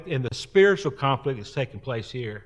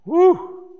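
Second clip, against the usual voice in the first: whoop; 9 dB louder than usual. Acted. Recalling heated Taco Bell fight.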